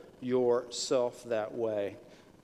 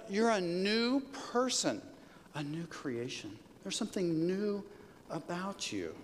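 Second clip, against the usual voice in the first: ordinary talk, nothing marked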